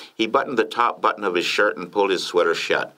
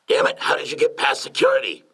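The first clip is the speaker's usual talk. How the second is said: higher, startled challenge to an intruder; confused demand; 7 semitones higher than usual. A narrator's reading. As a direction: demand an explanation, pitch raised. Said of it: "Lyndon asked with a confused look on his face".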